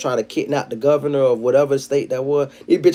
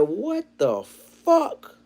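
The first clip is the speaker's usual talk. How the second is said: stunned disbelief; 8 semitones higher, 4 dB quieter, much slower than usual. Retold or imitated. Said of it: "I said".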